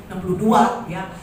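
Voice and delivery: ordinary talk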